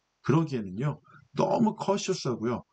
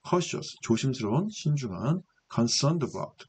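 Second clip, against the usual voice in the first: ordinary talk, nothing marked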